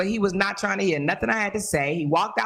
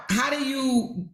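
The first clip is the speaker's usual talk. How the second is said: ordinary talk, nothing marked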